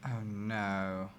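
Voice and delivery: usual speaking voice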